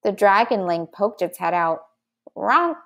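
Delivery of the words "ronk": short, higher creature squawk; baby dragon honk. A narrator's reading. Dragonling pokes its head out, honking. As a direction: give one small honk. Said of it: "it said".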